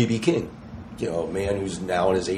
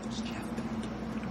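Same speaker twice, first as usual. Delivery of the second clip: awed whisper; 13 dB quieter, much slower than usual. Retold or imitated. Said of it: "And I look over and go".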